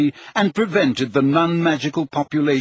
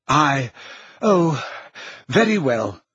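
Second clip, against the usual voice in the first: faltering, reluctant assent; weakly; slower than usual. A narrator's reading. Prime Minister gives in to the portrait, weakly. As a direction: give in weakly, in broken starts. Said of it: "said the Prime Minister weakly".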